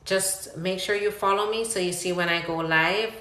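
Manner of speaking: ordinary delivery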